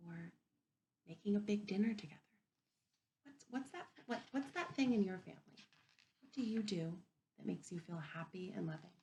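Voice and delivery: ordinary talk, nothing marked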